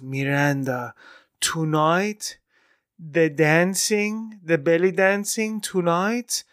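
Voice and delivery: slow Iranian mom with dramatic pauses; Iranian accent. Retold or imitated. Mom addressing the choreographer after the show, Iranian accent. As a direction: draw out the praise in an Iranian accent. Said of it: "my mom looks at her and she goes".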